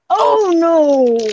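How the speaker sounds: high, loud drawn-out dismay; alarmed outburst. Real, not acted. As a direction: wail it as if you let a secret slip. Blurted a secret by accident.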